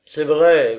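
plain reading voice

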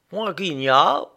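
a touch of sleepy grumble, lightly voiced; grumbling. A narrator's reading. Woken prisoner grumbling at his visitors, mild grumble. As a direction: grumble it like a man woken too early. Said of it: "grommela-t-il".

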